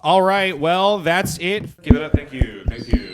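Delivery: loud call for applause; rally the crowd to clap; host hype shout. Real, not acted. Host calling on the audience to applaud, loudly.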